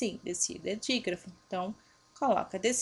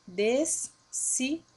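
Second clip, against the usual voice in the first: "descida" said slowly in separate syllables, des, si, da; split into syllables; 3 semitones higher, much slower than usual. Shown as a demonstration. Teacher shows the digraph separates, "descida" slowly split as des-si-da. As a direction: break "descida" into des... si... da, with gaps. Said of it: "Ele se separa".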